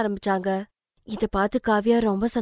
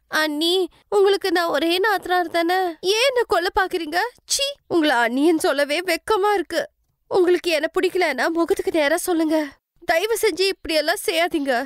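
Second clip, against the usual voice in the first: much higher, hammed-up hurt accusation; sister-in-law faking hurt to Alka; mock-wounded sister-in-law; 7 semitones higher than usual. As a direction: play the victim, pitched high. Kavya accuses Alka tearfully.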